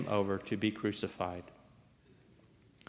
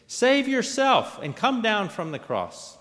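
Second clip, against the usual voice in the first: raised, jeering challenge; derision; 9 semitones higher, 3 dB louder than usual. A narrator's reading. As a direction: jeer at him from the roadside. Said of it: "Those who passed by derided him".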